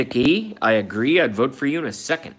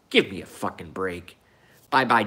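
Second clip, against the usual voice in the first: ordinary talk, nothing marked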